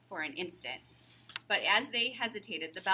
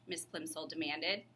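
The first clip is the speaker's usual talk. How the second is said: ordinary delivery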